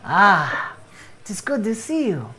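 welcoming missionary. Acted. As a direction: welcome the stranger earnestly. GM plays Bohan missionary.

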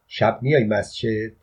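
ordinary delivery

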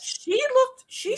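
high and loud gushing; excited gush. Real, not acted. Fan gushes about a tiny idol, high and loud. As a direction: gush over how small she is.

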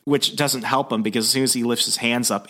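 ordinary delivery

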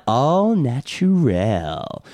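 drawn out. Acted. Drawn-out product slogan.

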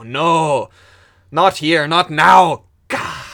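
the digger cries out in horror; anguished cry. Acted. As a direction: let the denial burst out. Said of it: "and he goes".

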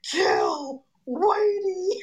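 high, slow, drawn-out stoned chant; stoned Farley quote. Retold or imitated. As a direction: stretch it out high like a stoned comic. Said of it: "he's like".

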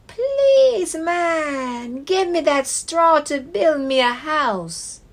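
high squeaky piglet voice; small pleading pig; little pig voice. Acted. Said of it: "and said to him".

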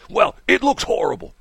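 imitated sniffy caller; complaining caller. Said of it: "He says".

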